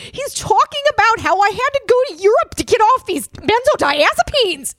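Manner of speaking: high, strained deranged-Muppet voice; Jordan Peterson. Retold or imitated. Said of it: "speaks in a voice that's like this".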